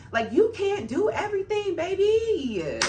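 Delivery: high, sassy put-on voice telling her off; mocking sass. Mocking the coworker directly.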